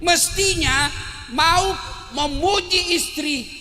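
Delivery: slow, loud, drawn-out point; slow loud preaching; slow emphasis. Real, not acted. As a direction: spell it out for the crowd. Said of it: "preacher stresses point".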